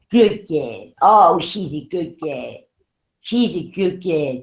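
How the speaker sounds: low, slow cooing to the dog; soothing a pet. Real, not acted.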